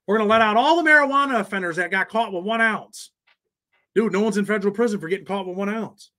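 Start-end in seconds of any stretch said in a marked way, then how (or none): 0.1-3.1 a higher, put-on politician voice, lightly done; mock Biden. Streamer mocks a president's promise on marijuana releases. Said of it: "do what Biden's doing".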